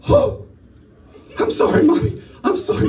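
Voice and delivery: put-on high, small apologetic child voice; a seven-year-old caught out, pleading sorry; sorry little kid. Young son apologising to his mom, high child voice. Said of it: "He goes".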